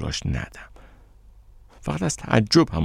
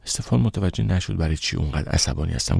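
plain reading voice

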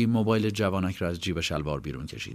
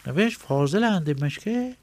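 Ayyub's voice; 11 semitones higher, 5 dB louder than usual. Acted. Ayyub voice continues.

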